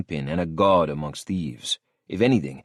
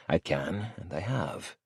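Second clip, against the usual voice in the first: plain reading voice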